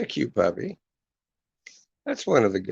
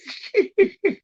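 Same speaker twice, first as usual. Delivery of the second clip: high laugh; 5 dB louder than usual. Real, not acted. Laughing at the prank.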